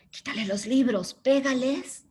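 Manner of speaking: a hint of a tempting voice in the child's ear; lightly voiced inner voice urging him to hit; voz insistente al oído, apenas actuada; imitating voice in the ear. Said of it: "imitando una voz en el oído".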